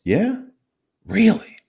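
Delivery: bright climbing "Really?" to keep them talking; encouraging questions. Shown as a demonstration. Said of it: "Nothing more than you saying".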